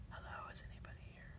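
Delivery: barely audible whispered question. Shown as a demonstration. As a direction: whisper a hushed hello into the recorder. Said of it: "now I'm gonna whisper".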